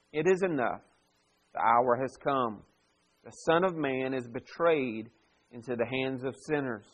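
soft, measured Bible reading; subdued reading aloud to a congregation; scripture reading. Acted. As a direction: read the passage solemnly, with pauses between phrases. Reads the scripture passage aloud.

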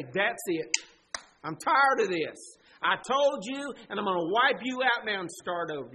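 loud, fed-up mock tirade; acted angry outburst. What God does not say to Adam, angry outburst. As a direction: erupt in exasperation, leaning hard on the words.

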